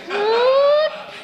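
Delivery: very high, dog-whistle pitch; high-pitched bafflement. Acted. Drunk driver asked for the ABCs backwards, high-pitched bafflement. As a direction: squeak it like a puzzled dog. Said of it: "Like the dog that hears that really high pitch".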